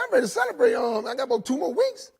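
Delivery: bragging, voice jumping up high; excited boast. Retold or imitated. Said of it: "I said".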